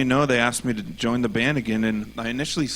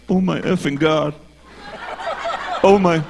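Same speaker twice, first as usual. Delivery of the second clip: much louder and higher, astonished; amazed exclamation; 7 semitones higher, 6 dB louder than usual. Retold or imitated. Retold fan feeling his healing, loud and astonished. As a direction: cry out in disbelief. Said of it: "and he's like".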